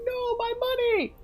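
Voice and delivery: high whiny cry; mock whiny wail. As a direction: wail over lost money like a cartoon. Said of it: "And you're like".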